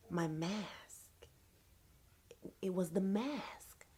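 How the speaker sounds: slow, hushed dramatic reveal; hushed revelation. Retold or imitated. Realizing what ruined her hair, hushed revelation. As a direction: let it dawn softly, with long pauses.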